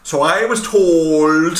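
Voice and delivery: loud, drawn-out mock Irish accent; hammed-up bad Irish accent, very slow and loud. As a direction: stretch it out in a terrible Irish accent. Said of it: "who has a worse accent".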